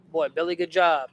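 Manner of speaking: ordinary talk, nothing marked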